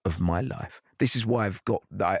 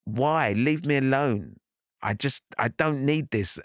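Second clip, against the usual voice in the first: higher, pained plea to be left alone; half-crying protest at the cameras; upset outburst; 6 semitones higher than usual. Retold or imitated. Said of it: "I swore at the camera".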